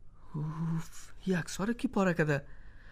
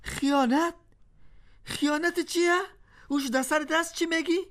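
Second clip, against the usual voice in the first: shocked disbelief; 7 semitones higher than usual. Acted. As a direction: pitch up in disbelief. Husband stunned by accusation. (ناباوری و حیرت)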